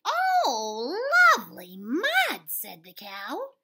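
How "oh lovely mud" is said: loud high cow cry of delight; big excited farm animal voice. A narrator's reading. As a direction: belt it out like a happy cow. Cow character in read-aloud.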